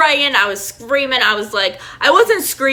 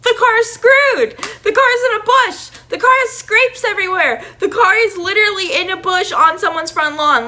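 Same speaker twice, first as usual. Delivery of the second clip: very high-pitched hammed-up wail; imitated bawling; 10 semitones higher, 3 dB louder than usual. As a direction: wail each phrase like a mock hysterical sob. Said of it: "I was bawling my eyes out".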